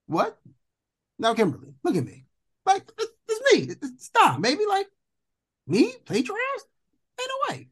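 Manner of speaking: high, squeaky pleading disbelief; incredulous disbelief. Retold or imitated. Telling the professor it can't be him, in shocked disbelief. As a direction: pitch it way up like you can't believe it.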